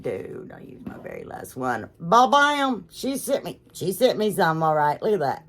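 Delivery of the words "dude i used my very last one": mock glum self-quote. Quoting her own grumble to the viewers, low and soft. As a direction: lower and softer, muttering it to herself. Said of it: "I'm like".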